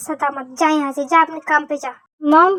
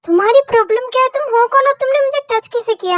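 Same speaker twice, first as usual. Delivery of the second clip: high-pitched, outraged demand; indignant confrontation; 7 semitones higher than usual. Acted. Woman confronts a stranger who touched her, indignant. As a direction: challenge him in a raised, much higher voice.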